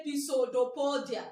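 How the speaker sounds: Pisodopodia with the silent P sounded as "pi"; every written part of Pisodopodia voiced; spelling pronunciation. Shown as a demonstration. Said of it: "So that I'll be able to write down".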